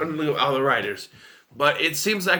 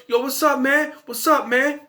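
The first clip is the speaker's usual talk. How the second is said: much higher, hammed-up slangy street-talk impression; 10 semitones higher than usual. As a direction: overdo the laid-back homie lingo, pitched way up. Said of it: "acts, you know".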